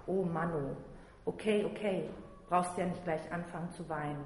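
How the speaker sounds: ordinary delivery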